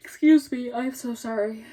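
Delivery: speaking through a yawn; sleepy words through a yawn. Real, not acted. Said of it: "I'm gonna start yawning".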